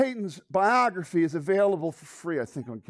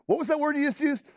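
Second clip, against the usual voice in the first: quoting Peyton's startled excitement, pitched higher; excited outburst; 8 semitones higher than usual. Retold or imitated. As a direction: jump on the words as if you just found treasure. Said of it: "Peyton jumped to his feet".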